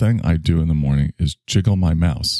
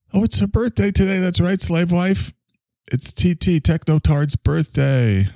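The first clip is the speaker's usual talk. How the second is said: high, slow showman voice; mock grand announcer; 12 semitones higher than usual. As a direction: announce it like a pompous host. Announcing wife's birthday.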